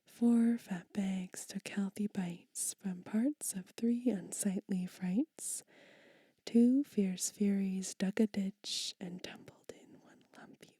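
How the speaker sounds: rhythmic whispered poem, slow and emphatic; poem reading. Acted. Whispered children's poem read aloud to ASMR viewers. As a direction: read the rhyme with a bouncing beat.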